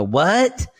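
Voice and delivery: high, rising, mildly yelled "what?"; mock yelled "what". Kids who didn't hear mom, mock yelled question. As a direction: imitate a kid calling back across the house. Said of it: "we were screaming, we'd go".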